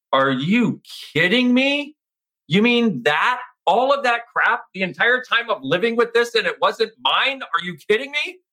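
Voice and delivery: high, disbelieving cry of realisation; incredulous. Retold or imitated. Retelling his shock that the crap wasn't his, incredulous and high. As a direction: voice jumping up in stunned disbelief. Retold shock at the sticky note.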